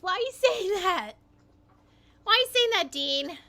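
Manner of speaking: high, loud, real objection; indignant protest. Real, not acted. Objecting to Dean's mocking remark.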